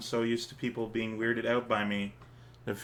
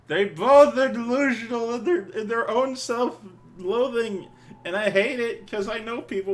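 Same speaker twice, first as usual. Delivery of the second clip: high, loud, drawn-out exasperation; real frustrated rant; exasperated outburst; 11 semitones higher, 6 dB louder than usual. Real, not acted. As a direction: vent at the characters, high and loud. Reacting to characters with exasperation.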